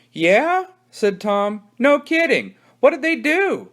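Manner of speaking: put-on high, curious kid voice; eager kid. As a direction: act wide-eyed and innocent. Tom feigns innocent interest.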